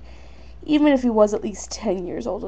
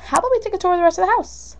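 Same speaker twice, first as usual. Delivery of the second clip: high, quick, eager suggestion; changing the subject; 9 semitones higher, faster than usual. A narrator's reading. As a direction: rush in high-voiced to steer the talk elsewhere. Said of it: "she said, changing the subject".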